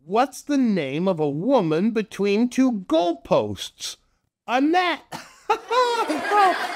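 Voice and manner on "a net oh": laughing punchline. Acted.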